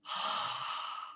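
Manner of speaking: soft breathy open ah; whispered ah. Shown as a demonstration. Coach demonstrates the whispered ah. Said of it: "the whispered ah".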